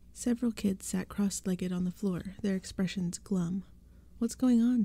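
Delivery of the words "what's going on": higher, louder, alarmed demand; shrill demand. A narrator's reading. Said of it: "Tabby demanded shrilly".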